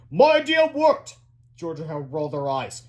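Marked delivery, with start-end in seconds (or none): 0.1-1.2 high, slow gloating shout; pompous. Acted. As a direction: crow about your triumph. Gloating scientist voiced.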